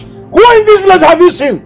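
high-pitched angry tirade; outraged rant. Acted. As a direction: rage indignantly, pitch far up. Father rages at son's behaviour.

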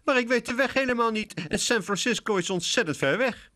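high whiny kitten voice; distressed kitten. A narrator's reading. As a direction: plead in despair. (wanhopig katje, hoog)

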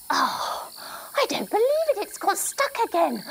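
high exasperated gasp; mock disbelief. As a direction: play up the frustration for kids. Tent zip stuck again.

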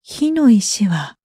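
ordinary delivery, nothing marked

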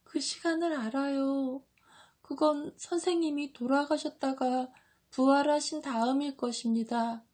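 high, weeping disciple voice; tearful. A narrator's reading. John foretells the death and resurrection, tearful. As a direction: plead through acted tears. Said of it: "하고 요한이 울면서 말한다".

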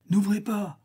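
high, urgent command, not a full shout; alarmed exclamation. A narrator's reading. Pluto refusing Federigo at hell's gate, exclaiming. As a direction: cry out to keep the door closed. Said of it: "s'écria Pluton".

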